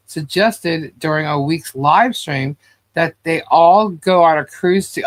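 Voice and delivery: ordinary talk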